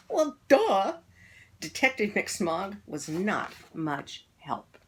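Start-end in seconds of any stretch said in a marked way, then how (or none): 0.1-1.0 loud, high sarcastic retort; sarcastic kid. A narrator's reading. Kids mocking the detective, loud sarcasm. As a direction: roll your eyes at the detective.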